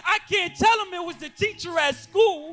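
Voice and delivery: preacher to abused youth in the room, loud and impassioned; full-force preaching. Acted. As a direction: cry it out to the congregation.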